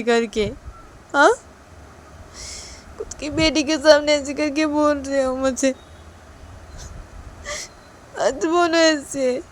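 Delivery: loud, high-pitched tearful accusation; crying reproach. Acted. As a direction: accuse him while breaking into tears. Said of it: "Ruhi रोने लगती है". (रोते हुए ऊँची आवाज़ में शिकायत)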